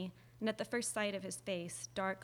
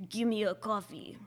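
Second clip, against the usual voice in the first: tough movie mobster voice, louder; Chicago gangster; 5 dB louder than usual. Retold or imitated. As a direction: growl it like a hoodlum. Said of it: "You sound like a Chicago gangster".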